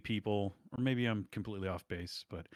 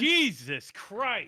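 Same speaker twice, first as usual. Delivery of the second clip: exasperated; 12 semitones higher, 7 dB louder, much slower than usual. Real, not acted. Exasperated at wrong name.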